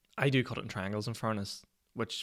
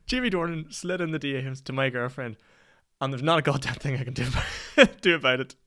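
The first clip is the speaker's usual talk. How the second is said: laughing loudly through the words; half-laughing, mock despair; 5 semitones higher, 5 dB louder than usual. Real, not acted. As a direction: speak it while cracking up. Laughing at Dornan messaging girlfriend.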